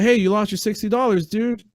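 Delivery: mild mock shout to the man who dropped money; calling out to stranger.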